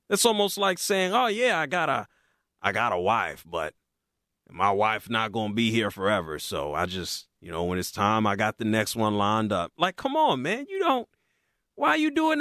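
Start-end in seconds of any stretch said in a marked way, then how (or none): 1.1-9.7 much lower, mock-smug rich guy; mock the owner with a lowered, smug voice; a lowered, lightly voiced caricature of a smug billionaire; mocking impression. Mocking the owner's imagined attitude.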